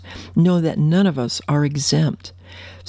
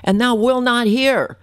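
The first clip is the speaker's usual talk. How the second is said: high, anguished pleading lament; high impassioned prophet's plea; prophet's cry; 7 semitones higher than usual. Acted. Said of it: "this is the prophet saying".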